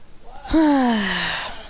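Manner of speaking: long acted sigh; exasperated sigh. A roommate reacts to being told off, exasperated sigh. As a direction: let out a drawn-out sigh. Character's wordless reply to scolding.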